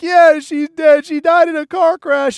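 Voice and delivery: high, loud, hysterical imitation; mock crying wail. Crying woman explaining her friend's death, hammed-up wail. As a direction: wail it slowly like a distraught caller. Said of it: "she's fucking crying".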